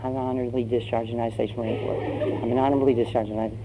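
quick, hushed self-reminder; timid muttering. Acted. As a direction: mutter a memorized line fast under your breath. Recruit nervously rehearses his memorized line.

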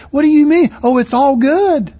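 mock exchange. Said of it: "you're scratching your head going".

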